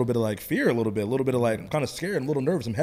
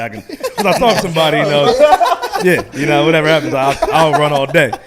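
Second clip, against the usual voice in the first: laughing loudly through the words; 5 semitones higher, 12 dB louder than usual. Real, not acted. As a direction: talk while cracking up. Laughing while bragging about talking.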